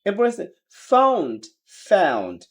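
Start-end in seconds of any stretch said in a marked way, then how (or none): 0.9-1.4 high British "found" with AH-oo; British accent. Shown as a demonstration. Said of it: "ব্রিটিশরা আও বলে". 1.9-2.4 low American "found" starting on AE; American accent. Shown as a demonstration. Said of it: "আমেরিকানরা অ্যাও বলে".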